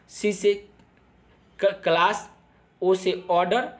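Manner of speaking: normal talking voice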